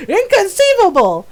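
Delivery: high, loud quoted exclamation; hammed-up incredulous outburst; mock disbelief. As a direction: cry it out like an outraged movie line. Quoting a film character's exclamation.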